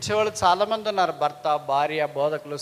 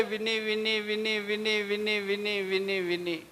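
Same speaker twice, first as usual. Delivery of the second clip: monotone repetition; 3 semitones higher, 4 dB quieter, slower than usual. Retold or imitated. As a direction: repeat it evenly on one raised note, wearily endless. Mimes endlessly hearing complaints.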